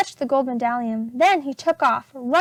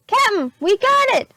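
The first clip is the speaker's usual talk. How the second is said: high, loud pirate shout; excited shout; 8 semitones higher, 5 dB louder than usual. A narrator's reading. Pinky shouting to Captain Bones, high and loud. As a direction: yell the good news up to the captain. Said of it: "shouted Pinky".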